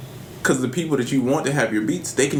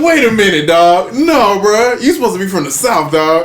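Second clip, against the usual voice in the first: high, loud disbelief; loud mock outrage; 7 semitones higher, 9 dB louder than usual. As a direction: call it out like you've been fooled, playing up the shock. Retells shock at actor's real accent.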